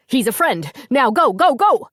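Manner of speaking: very high, loud urgent go go go; driving his family on; desperate rising command; frantic shout. A narrator's reading. Angus urging wife to flee.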